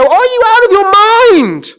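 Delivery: loud, high incredulous shout; Ninevites' yelled rebuke. Acted. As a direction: fling it at them in disbelief. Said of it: "will stand up and go".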